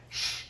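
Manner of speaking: long soft "shh"; quiet, sustained shush. Shown as a demonstration. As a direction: demonstrate a hushing breath. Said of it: "He just went like this".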